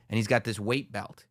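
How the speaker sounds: ordinary talk, nothing marked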